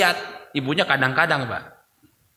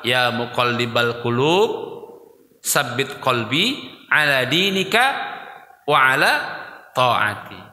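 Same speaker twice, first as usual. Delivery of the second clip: prayer recitation; 5 semitones lower than usual. Acted.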